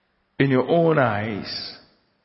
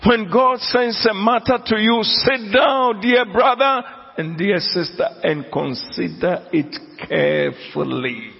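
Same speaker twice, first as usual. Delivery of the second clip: much higher, raised full-force sermon; fiery preaching; 6 semitones higher than usual. Acted. Preacher challenging his congregation about Saul, at full force. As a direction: drive the question at the congregation.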